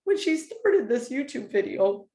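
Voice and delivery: fighting back tears while telling it; getting emotional. Real, not acted. Telling her viewers about a friend's praise, choking up. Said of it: "now I'm gonna get emotional".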